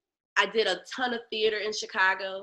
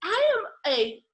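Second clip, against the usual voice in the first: higher, lofty insistence on being serious; indignant protest; 7 semitones higher than usual. Retold or imitated. To the director, a higher haughty classically trained protest. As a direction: draw yourself up and insist. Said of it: "I was like".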